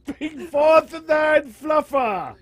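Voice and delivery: high, slow, shouted through laughter; laughing proclamation. Acted. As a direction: shout it out, cracking up. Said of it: "you really fucking nailed that one".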